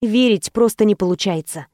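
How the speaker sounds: plain reading voice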